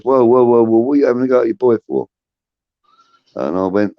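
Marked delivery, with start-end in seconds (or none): 0.0-2.1 higher, louder, fast whoa whoa boss; mock alarmed boss. Boss walks in on him having a go at his son, loud and fast. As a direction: hurry in to break it up.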